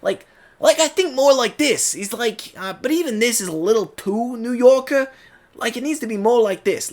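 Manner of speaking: high, youthful New York accent; younger New Yorker. Shown as a demonstration. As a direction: pitch it up like a young Brooklyn guy. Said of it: "He needed to be a little bit higher".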